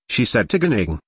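plain reading voice

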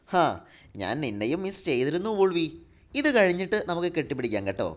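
ordinary delivery

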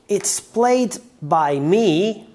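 "played" drawn out slow and loud with a rising end; slow teaching read of "It's played by me"; stress on played. Shown as a demonstration. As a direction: lean slowly on "played" and lift at the end. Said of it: "If you want to say".

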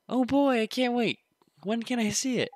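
put-on high, loud eager kid voice; excited kid. Kid begging Phil to see it, high excited voice.

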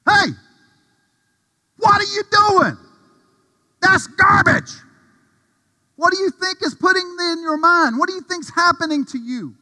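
high, loud scolding shout; Matthew yelling. Acted. Preacher voicing Matthew shouting at him in the truck. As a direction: yell at him to get the garbage out of his mind. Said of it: "This is Matthew".

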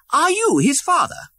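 put-on high young voice, amazed; astonished boy prince. A narrator's reading. Prince facing Tom's father, high and amazed. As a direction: cannot believe it.

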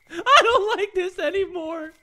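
high, whimpering complaint; half-sob it out; mock-tearful whine; whiny crying. Real, not acted. Said twice after scare.

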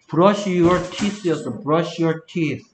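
each word of "Brush your teeth" drawn out very slowly; slow model reading. Shown as a demonstration. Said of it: "듣고 따라 말해".

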